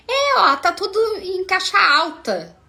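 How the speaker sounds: high, loud acted comeback; insistent retort. Acted retort of accusing patient.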